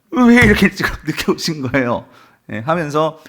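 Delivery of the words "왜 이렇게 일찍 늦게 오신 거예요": mock lament. Mary asking the Lord why he came so late, mock wailing. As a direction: imitate someone wailing a complaint. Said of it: "이 통곡하시고".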